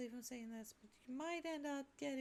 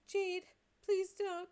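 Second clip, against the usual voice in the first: pleading; 5 semitones higher, 10 dB louder, slower than usual. Acted. Begging Jade not to cry.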